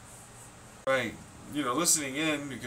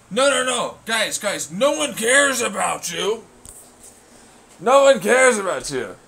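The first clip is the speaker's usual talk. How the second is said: loud, high outburst at eavesdroppers; shouting; 8 semitones higher, 12 dB louder than usual. Real, not acted. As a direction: yell at them that nobody cares. Yelling at the listening agents.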